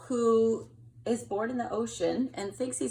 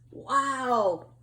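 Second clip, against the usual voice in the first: amazed wow; 3 semitones higher, 5 dB louder than usual. Acted. Kelp amazed.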